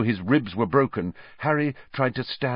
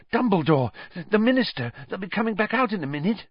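panting, hurried; 10 semitones higher, faster than usual. A narrator's reading. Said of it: "Hermione panted".

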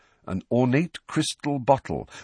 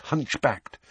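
ordinary delivery

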